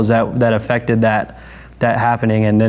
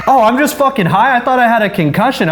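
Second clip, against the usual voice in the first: excited relief; 10 semitones higher than usual. Retold or imitated. Relief at being high.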